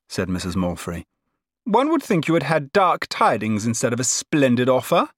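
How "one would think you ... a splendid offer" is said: chiding. A narrator's reading. Cousin chiding, gushing.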